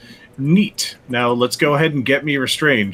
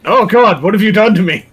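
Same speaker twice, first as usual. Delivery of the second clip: higher, louder cry of alarm; acted dismay; 6 semitones higher, 6 dB louder than usual. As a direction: play up the horrified protest. Character reacts to being tied up.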